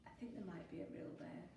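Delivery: low, quick, soft voice; hushed warning. A narrator's reading. Fox warning friends of a bear, hushed. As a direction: confide it under your breath.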